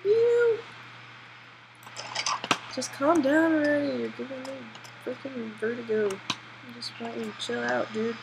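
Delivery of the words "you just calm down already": exasperated. Real, not acted. Exasperated at character.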